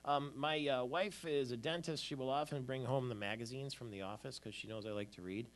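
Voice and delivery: ordinary talk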